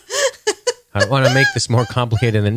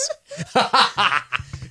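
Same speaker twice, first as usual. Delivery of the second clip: ghostly laugh; 11 semitones higher than usual. Pretend. Naked Logan ghost laugh.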